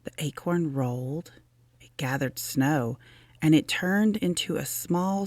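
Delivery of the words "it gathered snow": usual speaking voice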